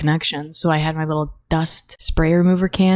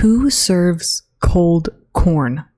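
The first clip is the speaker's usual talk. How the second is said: drawn-out, disgusted question; slow disbelief; much slower than usual. Real, not acted. Vlogger outraged that her camp corn was served cold, slow disbelief. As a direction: stretch each word in disbelief.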